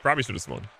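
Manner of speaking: usual speaking voice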